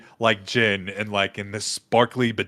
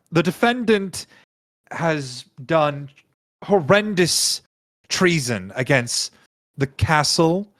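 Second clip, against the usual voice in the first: higher, halting courtroom declamation; grandstanding royal accuser; 7 semitones higher, 4 dB louder than usual. Acted. Ruler accusing the defendant before the judge, grandly aggrieved. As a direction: declare each charge with weighty pauses. Game master voices Delilah's statement.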